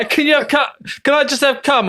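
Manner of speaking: high mock whiny request. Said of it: "you went".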